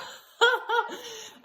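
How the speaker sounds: bursting into a high, loud laugh; high delighted laugh. Real, not acted. Mom laughing at her friend's prudishness, high and loud. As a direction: laugh out loud, tickled by her own reply.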